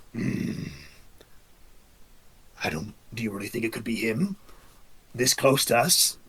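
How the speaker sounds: a hint of a low, wary commander; lightly voiced orc commander. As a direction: muse quietly as the orc leader. Orc commander musing aloud.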